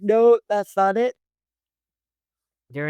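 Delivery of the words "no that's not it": louder, higher retold denial; a captive firmly rejecting the theory, louder; mock shout. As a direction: throw the denial back, raised and loud. Retold interrogation, suspect denies.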